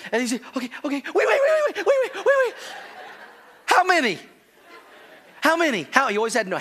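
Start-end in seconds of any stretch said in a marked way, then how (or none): 0.5-2.5 high frantic string of waits; hammed-up child stalling in panic; panicked stalling. Son stalls before spanking. 3.6-6.1 put-on high anxious little boy asking; nervous kid pressing for a number; anxious child. Son asks how many.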